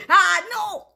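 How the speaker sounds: very high, loud wail; cried refusal. A narrator's reading. La L seeing the paper at her eyes, crying out. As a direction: cry out in protest.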